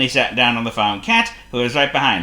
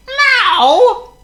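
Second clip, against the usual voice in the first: high, loud meow screech; high loud cat screech; 10 dB louder than usual. A narrator's reading. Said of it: "screeched the cat".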